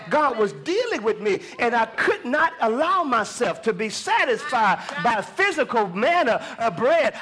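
driving, emphatic preacher's cadence; preaching. Real, not acted. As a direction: build the testimony like a preacher.

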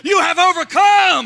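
loud, high declaration; triumphant shouted line; preaching shout. Real, not acted. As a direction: shout it over the crowd.

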